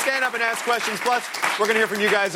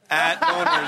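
"At boners" said much higher with a laugh; laughing repeat; 8 semitones higher, slower than usual. Shown as a demonstration. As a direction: relish the silly name, laughing. Repeats username in disbelief.

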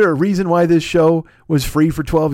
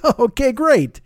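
high, put-on mock cheer; sarcastic acceptance; 10 semitones higher, 3 dB louder than usual. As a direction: retell it as an exasperated "thanks a lot". Sarcastic retort to listener's joke.

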